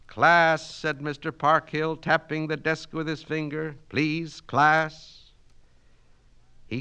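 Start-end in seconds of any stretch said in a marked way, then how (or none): 0.1-5.3 calling to order. Acted. Teacher calls class to order.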